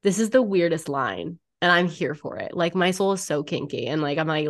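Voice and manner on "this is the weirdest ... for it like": normal talking voice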